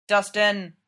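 usual speaking voice

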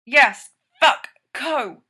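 forced through gritted teeth; strained through clenched jaw. A narrator's reading. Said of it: "through gritted teeth".

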